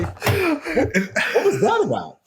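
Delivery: high-pitched incredulous question; comic high confusion; baffled. Retold or imitated. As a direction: ask it in squeaky, puzzled disbelief. Asking the musician, baffled.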